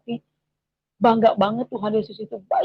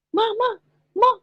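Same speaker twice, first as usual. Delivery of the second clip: retold alarmed calling; 11 semitones higher than usual. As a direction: call out for Mum in mild alarm, pitched high. Child calls mother about a devil.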